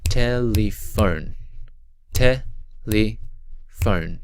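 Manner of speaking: TELEPHONE slowly in three beats, TE - LE - PHONE; split into syllables. Shown as a demonstration. Teacher counting three syllables, TELEPHONE slowly broken apart. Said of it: "Telephone is three syllables".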